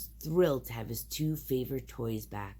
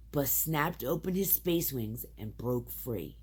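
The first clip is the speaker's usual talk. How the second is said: ordinary delivery, nothing marked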